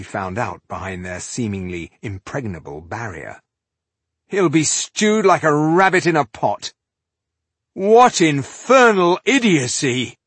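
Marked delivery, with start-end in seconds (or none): 4.3-6.7 loud, high, triumphant boast; gleeful crowing. A narrator's reading. Warrior cheers at foe's doom. 7.7-10.2 loud, high, drawn-out outburst; angry exclamation. A narrator's reading. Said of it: "Tungdil exclaimed".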